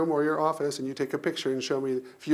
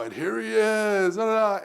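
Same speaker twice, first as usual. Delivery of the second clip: stage introduction; 6 semitones higher than usual. Retold or imitated. Announcer bringing him onto the stage, drawn-out showy introduction. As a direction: announce the star with a sung-out fanfare.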